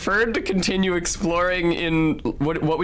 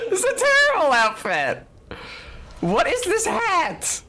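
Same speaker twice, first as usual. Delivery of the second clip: laughing. Real, not acted. Laughing at silly outfit.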